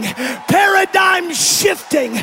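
preaching. Acted. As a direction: preach it to the rafters. Preaching at full force.

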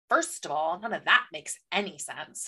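low, soft, slow and dry; deadpan dismissal. Retold or imitated. Reader answering the scaly wolf description, deadpan dismissal. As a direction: count off objections with weary sarcasm.

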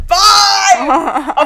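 high, loud shouted Fine through laughter; laughing retold yell at a friend's dare. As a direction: yell it back like accepting a dare. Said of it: "And I said".

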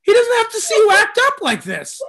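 high, loud, laughing through the jab; laughing retort. Retold or imitated. Comic retelling his cruel retort to a heckler, laughing. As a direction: crack up while delivering the insult.

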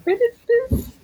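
high, crying-voiced question; reacting to the Daily Bugle, high and whiny; whiny disbelief. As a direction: whine it in dismay. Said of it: "And I'm like".

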